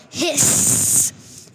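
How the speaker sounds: drawn-out, loud sibilant hiss; the word "hiss" stretched into the sound; skunk hiss. A narrator's reading. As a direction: hiss it out long like an angry skunk. Skunk hisses in the excerpt.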